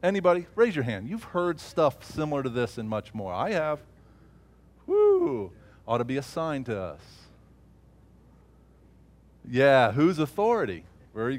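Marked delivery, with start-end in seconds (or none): 4.9-5.5 high, loud whoop of joy; excited whoop. Real, not acted. Preacher whooping at the congregation's response, excited. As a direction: let out a joyful shout.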